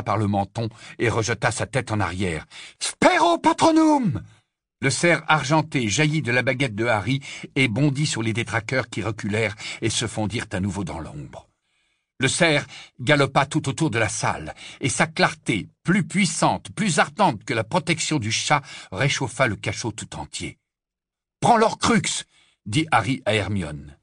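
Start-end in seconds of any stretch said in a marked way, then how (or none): 2.8-4.2 very high, drawn-out spell cry; shouted incantation. Acted. Spell shouted against dementors. 21.4-22.2 higher-pitched brisk command; urgent order. Acted.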